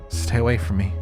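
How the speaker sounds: a clear spoken plea, not whispered; full voice. Shown as a demonstration.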